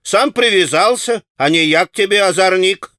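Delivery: a market woman raising a fuss; shouting. A narrator's reading. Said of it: "раскричалась Марья".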